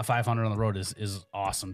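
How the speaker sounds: normal talking voice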